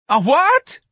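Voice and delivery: very high, loud shriek; wild scream. Acted. Mrs. Riley shocked at mention of a baby, screaming wildly. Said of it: "screamed wildly".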